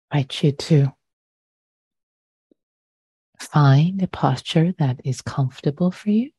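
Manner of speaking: unhurried, measured meditation voice with long pauses; guided meditation. Real, not acted. Meditation teacher inviting listeners to settle their posture, calm and unhurried. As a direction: guide gently into stillness, leaving long silences.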